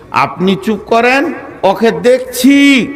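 high, drawn-out promise to deal with him; committee man stepping in. Acted. Committee man to the crying preacher about his attacker, high emphatic assurance. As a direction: pitch it up and stretch the last word. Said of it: "এটা বলবি".

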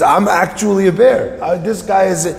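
high-pitched acted protest; worked-up storyteller's argument; arguing. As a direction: plead your case in exasperation. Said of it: "And they're arguing, arguing".

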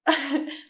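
short real chuckle; amused laugh. Real, not acted. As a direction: chuckle at being compared to a deer. Amused by the deer comparison.

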